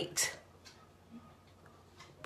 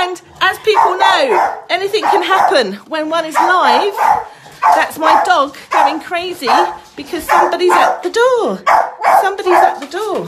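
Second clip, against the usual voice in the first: raised voice over the dog; talking over barking; 10 semitones higher, 17 dB louder than usual. Real, not acted.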